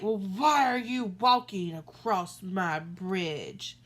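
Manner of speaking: troll questioning the goat, slow and loud. Acted. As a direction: demand it like a cranky troll. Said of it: "the troll's like".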